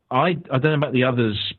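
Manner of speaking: usual speaking voice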